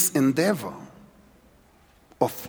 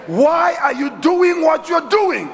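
higher, louder, slow, pressing question; preaching shout; 10 semitones higher, 5 dB louder than usual. Shown as a demonstration. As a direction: hurl the question at the crowd. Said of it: "Have you ever asked yourself".

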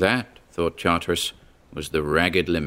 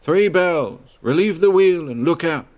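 high, louder call heard from far below water; dim distant call; 10 semitones higher, 4 dB louder than usual. Acted. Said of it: "a dim voice".

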